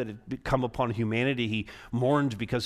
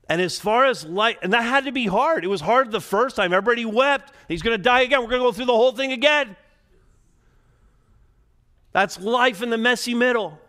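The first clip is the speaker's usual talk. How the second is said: rising preaching; 8 semitones higher, 4 dB louder, faster than usual. Acted. Preacher rises to full force.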